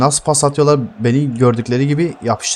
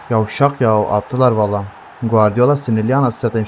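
ordinary delivery

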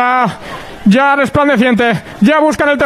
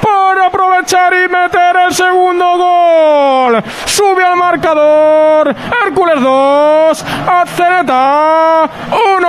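breakneck goal commentary; 5 semitones higher than usual. Real, not acted. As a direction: shout the goal news, drawn out. Goal replay, live commentary.

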